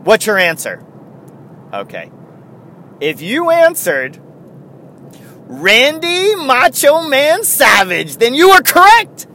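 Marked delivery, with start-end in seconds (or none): 3.0-9.3 game show reveal. Acted. Trivia answer reveal.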